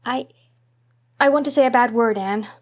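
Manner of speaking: put-on a boy forcing the words out; desperate blurt. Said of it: "blurted out Davy with a desperate effort".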